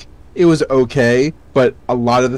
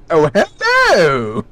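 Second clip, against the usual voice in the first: imitated Australian-Scottish greeting, drawn out and higher; Australian-Scottish accent; 4 semitones higher, much slower than usual. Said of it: "like, a, an Australian, Scottishy kind of accent".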